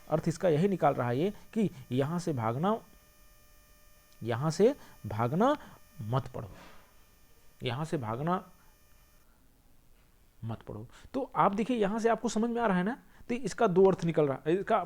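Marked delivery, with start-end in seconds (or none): 4.2-6.5 slow rising यहाँ से भागना, break, lower मत पढ़ो; pause after भागना. Shown as a demonstration. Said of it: "comma". 7.6-10.9 rising यहाँ से भागना, long break, soft low मत पढ़ो; long comma after भागना, मत पढ़ो soft and low; pause after भागना. Shown as a demonstration. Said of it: "comma".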